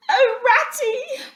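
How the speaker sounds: high, slow wailing cry; dismal cry. A narrator's reading. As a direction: wail his friend's name in misery. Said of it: "he cried dismally".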